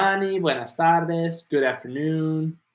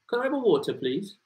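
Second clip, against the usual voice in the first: British request with r-less "water"; British accent; 4 semitones higher than usual. Shown as a demonstration. Teacher imitating a British customer asking for water, British accent. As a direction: ask it like a Brit, WAW-tuh for water. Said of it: "Ellos pronuncian agua diferente".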